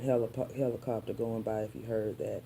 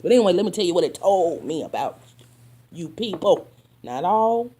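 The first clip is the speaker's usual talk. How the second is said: higher, louder and fast, calling people out; 6 semitones higher, 10 dB louder than usual. Real, not acted. Telling off the people who wronged her, loud and fast. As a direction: raise the voice and fire it at them.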